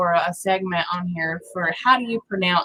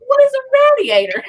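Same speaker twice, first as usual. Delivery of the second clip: high, fast and louder; mock bewildered question; confused; 5 dB louder, faster than usual. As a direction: blurt it out baffled. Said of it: "I was like".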